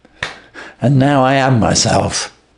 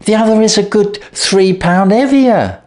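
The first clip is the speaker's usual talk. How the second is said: sister's voice; 11 semitones higher than usual. A narrator's reading. Sister voiced in retold tale.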